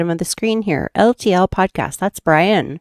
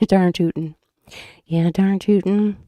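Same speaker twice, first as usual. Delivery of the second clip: put-on Minnesota accent. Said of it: "curses of living up here in Minnesota".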